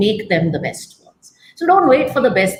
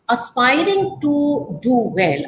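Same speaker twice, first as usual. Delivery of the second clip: ordinary delivery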